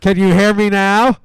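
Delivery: loud. Real, not acted. Testing if heard on phone.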